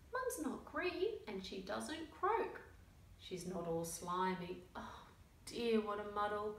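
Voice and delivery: lively picture-book read-aloud with character voices; reading to children. A narrator's reading. Reading a picture book to young children, lively storytelling. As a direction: tell the story to little listeners.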